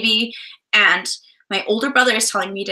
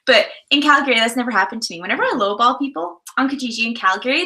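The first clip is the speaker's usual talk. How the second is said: ordinary talk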